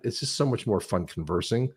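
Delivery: ordinary talk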